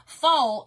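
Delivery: "foal" said loud, for foil; Southern vowel. Shown as a demonstration. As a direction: punch out "foal" with no "oy" sound. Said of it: "she said I'm saying".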